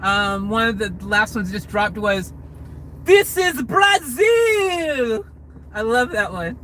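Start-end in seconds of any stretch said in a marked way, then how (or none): 3.0-5.2 high, slow, shouted movie-hero line, much louder; Fast and Furious line. Retold or imitated. Vlogger quotes her favourite Fast and Furious line, shouted high. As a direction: belt it out like a dramatic action movie line. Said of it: "my favorite line in the Fast and Furious movie".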